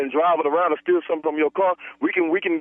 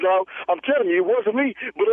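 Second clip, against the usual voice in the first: ordinary talk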